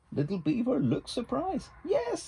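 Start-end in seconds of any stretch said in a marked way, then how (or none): 1.8-2.3 very high squeal of joy; a sudden high burst of delight; delighted yes. Acted. Little Beaver realises he has friends, high delighted yes.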